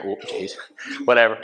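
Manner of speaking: normal talking voice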